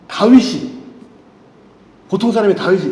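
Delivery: ordinary talk, nothing marked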